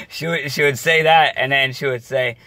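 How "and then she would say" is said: usual speaking voice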